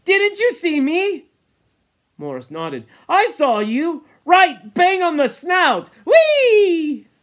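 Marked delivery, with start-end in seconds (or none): 0.0-1.3 high, loud, drawn-out question; eager appeal. Acted. Ralph wanting a witness to his throw, excited plea. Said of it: "He felt the need for a witness". 3.1-7.1 loud, high, rising to a whee; gleeful excitement. Acted. Morris confirming the hit to Ralph, gleeful shout.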